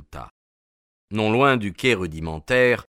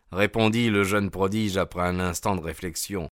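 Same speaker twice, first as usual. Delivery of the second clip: ordinary delivery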